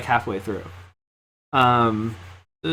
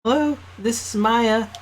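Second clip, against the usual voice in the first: lightly voiced young woman, higher; a hint of a bubbly girl answering; Maya voice; 4 semitones higher than usual. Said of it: "I have to do a voice for Maya".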